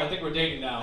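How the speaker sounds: ordinary talk, nothing marked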